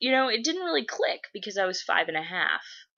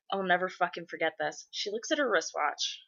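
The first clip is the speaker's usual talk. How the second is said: usual speaking voice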